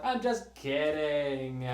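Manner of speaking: slow, drawn-out, hesitant delivery; lightly mimicking an actress losing her line; imitating a flubbed line. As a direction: drag the line out like it's forgotten. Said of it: "almost like she forgot her line".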